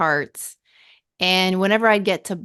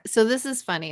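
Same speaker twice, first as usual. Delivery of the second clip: ordinary delivery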